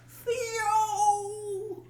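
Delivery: high drawn-out Theo call; Bill Cosby impression. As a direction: stretch out Theo, high and whiny. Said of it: "you gotta kinda Theo into it".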